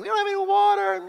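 high, whiny complaining voice; mock the grumbling crowd; whining. The thirsty Israelites grumbling at Moses, whiny. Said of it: "The people are just whining and complaining".

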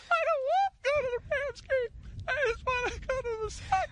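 very high, squeaky whimpering Australian voice; high, strangled mock-Messi whine about the Rams game. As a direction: whine like a tearful little Messi with an Australian accent. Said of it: "That's Messi if he were Australian".

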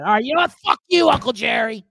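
loud, fed-up outburst; angry shout. Acted. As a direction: finally snap at him. Blowing up at the uncle.

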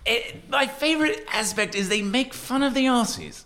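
high-pitched hammed-up British accent; put-on British accent. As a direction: play a British critic with a silly high accent. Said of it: "Their British accent".

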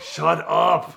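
hammed-up demon-possessed shout at the Bible lady; mock shout. Snapping again, louder.

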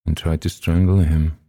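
ordinary delivery, nothing marked

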